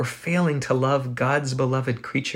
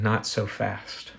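softer and slow, a calm "Not so fast."; quiet slow Jesus; 3 semitones lower, 8 dB quieter, slower than usual. Acted. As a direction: gently stop them in their tracks, softly and slowly. Jesus voiced, gentle check.

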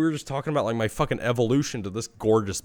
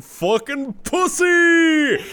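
put-on drunken shout; 6 dB louder, much slower than usual. Own drunk retort to mom.

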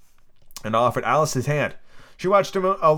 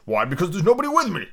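higher-pitched exclamation; exclaiming; 7 semitones higher than usual. A narrator's reading. Humpty Dumpty answers Alice, exclaiming. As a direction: cry it out in character. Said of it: "cried Humpty Dumpty".

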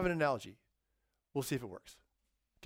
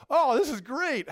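mock delight; 9 semitones higher, 8 dB louder than usual. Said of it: "on the court going".

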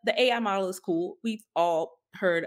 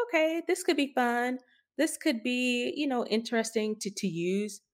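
higher, lightly mocking eager user; mock enthusiasm; 6 semitones higher, 3 dB quieter than usual. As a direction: play the naive fan, half-teasing. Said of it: "It's like".

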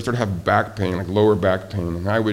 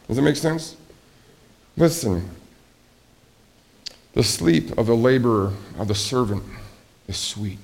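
ordinary delivery

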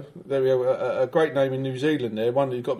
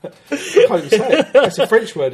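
laughing; 7 semitones higher, 7 dB louder than usual. Real, not acted. Trying to pronounce foreign title.

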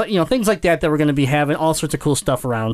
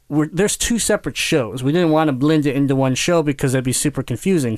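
ordinary talk, nothing marked

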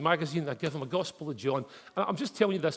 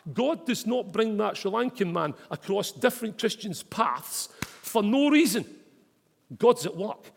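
rising to a loud, slow finish; preaching build; 6 semitones higher, 5 dB louder than usual. Real, not acted. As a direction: drive the point home, slowing at the end. Preaching climax.

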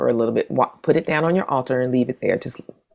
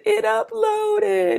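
very high, drawn-out squeal; mock tiny-voiced wonder; hammed-up amazement; much slower than usual. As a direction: squeak it slowly in giddy disbelief. Retold astonished relief after upload.

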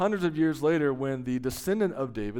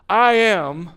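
proclaimed name; 6 semitones higher, 11 dB louder than usual. Acted.

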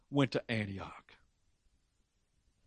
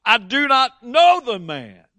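much higher, louder swearing denial; cursing denial; 7 semitones higher, 7 dB louder than usual. A narrator's reading. Preacher reading Peter's cursing denial, loud and high. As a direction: Peter curses while denying Jesus, loud and high. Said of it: "began to curse and swear".

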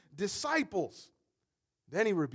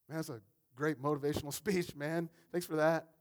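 sarcastic; 6 semitones lower, 5 dB quieter than usual. Retold or imitated. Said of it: "You'd be like".

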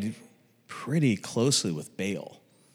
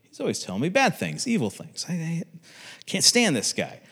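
complaining; 7 semitones higher than usual. Retold or imitated. Retells Ahab griping about hated prophet.

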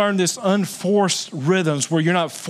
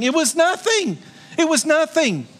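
emphatic insistence; 11 semitones higher than usual. Real, not acted.

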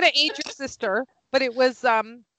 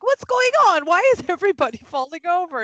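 much higher, louder mock-innocent dad; 7 semitones higher, 5 dB louder, faster than usual. Dad wonders why guests are falling over, mock-innocent and high. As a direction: play dumb, wide-eyed and loud. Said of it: "her dad's just like".

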